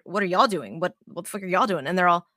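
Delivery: ordinary talk, nothing marked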